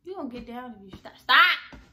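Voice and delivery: sharp shout of stop; scolding. Real, not acted. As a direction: snap at them to quit. Scolding someone mid-intro.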